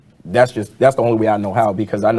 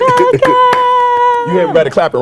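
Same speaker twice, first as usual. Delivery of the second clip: fighting game announcer; 6 dB louder than usual. Pretend. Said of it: "announcer voice".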